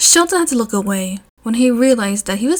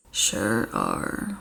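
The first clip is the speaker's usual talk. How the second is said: much lower and softer; deadpan suggestive, low; deep fanfic Eraser; 12 semitones lower, 9 dB quieter than usual. A narrator's reading.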